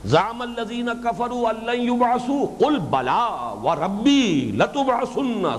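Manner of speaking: slow, high, melodic Arabic recitation; measured scriptural chant; Quran recitation. Real, not acted. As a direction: recite with stretched long vowels and swells.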